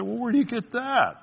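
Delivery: high-pitched sceptical comeback; mock objection, pitched well up; challenging listener. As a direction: push the question up like a doubter objecting. Voices a doubting listener's challenge.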